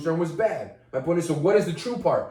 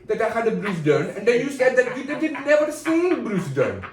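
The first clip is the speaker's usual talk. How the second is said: put-on higher, emphatic Indian accent; 4 semitones higher than usual. Accusing co-host of ignorance, high emphatic Indian accent. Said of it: "I know how good my Indian is".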